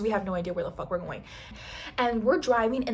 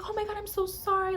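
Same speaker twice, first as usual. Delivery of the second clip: high-pitched mock apology; apologetic drunk friend; 11 semitones higher, faster than usual. Drunk friend who spilled her drink on the cereal, mock apologetic. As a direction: imitate a tipsy girl gushing sorry. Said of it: "she was genuinely sorry".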